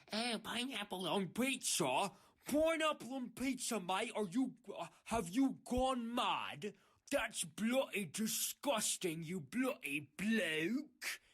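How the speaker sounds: loud, high mock-British outrage; slow, hammed-up British indignation; British accent. VR chat user appalled at pineapple pizza, mock British accent. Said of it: "every time they turn British too".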